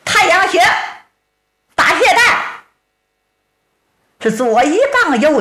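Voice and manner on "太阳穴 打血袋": shouting while fighting. Acted. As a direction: bark out each target as you strike. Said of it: "一边打一边喊".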